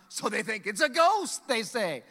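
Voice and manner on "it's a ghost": higher, louder "ghost!" in mock fright; a startled disciple crying out; frightened cry.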